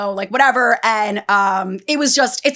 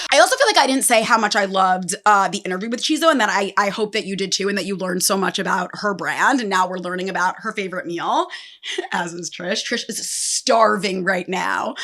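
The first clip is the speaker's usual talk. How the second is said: normal talking voice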